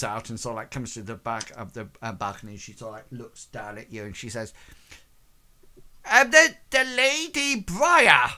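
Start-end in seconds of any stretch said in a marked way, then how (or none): 6.1-8.3 high, loud echoing herald call; echoey announcement. Acted. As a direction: announce the lady grandly down the hall. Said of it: "in her best echoey voice".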